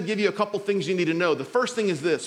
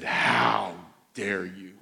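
low, hushed, indignant wife voice; a hammed-up wife's cold fury; mock outraged wife; 12 semitones lower, much slower than usual. Pastor imitating his wife's low, cold outrage. Wife furious in bed next morning.

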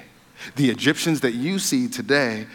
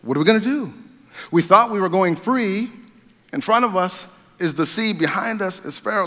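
panicked people; 7 semitones higher than usual. Retold or imitated. Israelites trapped between sea and Pharaoh, higher panicked cry. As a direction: play the frantic Israelites, trapped. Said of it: "now they're like".

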